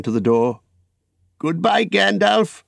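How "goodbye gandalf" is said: high, lingering goodbye; cheerful farewell. Acted. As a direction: call a happy goodbye to a friend. Bilbo bids Gandalf farewell.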